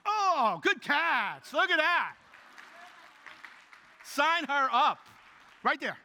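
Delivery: loud, high cheering for the catch; real excited praise shouted to the crowd. Real, not acted. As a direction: hype up the great catch. Cheering an audience member's catch.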